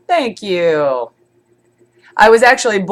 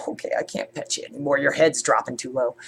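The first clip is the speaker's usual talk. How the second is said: soft pet talk; 6 semitones lower, 9 dB quieter, faster than usual. Real, not acted. Talking softly to her pet.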